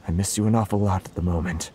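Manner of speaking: ordinary talk, nothing marked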